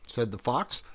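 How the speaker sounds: ordinary delivery, nothing marked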